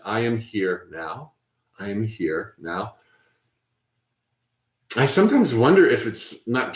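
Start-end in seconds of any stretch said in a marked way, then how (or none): 0.0-3.0 slow, softer repeated mantra; a quiet affirmation to settle the mind. Shown as a demonstration. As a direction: murmur it to yourself, calm and unhurried. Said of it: "Mine is".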